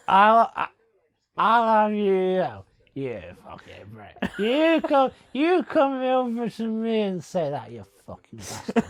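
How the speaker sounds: slow, menacing pub-bloke challenge; hammed-up hard-man threat; mock aggressive bloke. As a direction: lean in like a bloke picking a fight. Continues acted pub confrontation.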